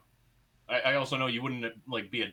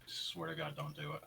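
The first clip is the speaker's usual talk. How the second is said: very soft, higher-pitched plea; 5 semitones higher, 12 dB quieter than usual. Real, not acted. Birthday man warning co-host not to announce it, hushed. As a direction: warn him under your breath.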